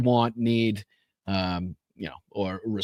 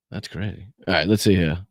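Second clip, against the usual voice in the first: ordinary delivery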